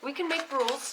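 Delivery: usual speaking voice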